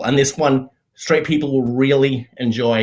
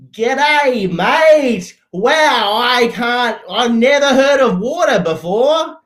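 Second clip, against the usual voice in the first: high, loud, hammed-up Australian; Australian accent; 10 semitones higher, 5 dB louder, slower than usual. Imagined Australian who never heard of water, exaggerated Australian accent. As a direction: put on a broad mock-Australian voice, pitched way up. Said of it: "let me do an Australian accent".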